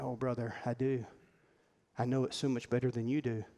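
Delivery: hushed, understanding reply to a friend; knowing, gentle Jesus. Acted. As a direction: answer the boast quietly, pitched low. Jesus gently answers boastful Peter.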